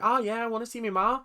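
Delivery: high-pitched, badly faked Irish accent; terrible Irish accent. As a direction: attempt an Irish accent and miss. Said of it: "which is the worst Irish accent".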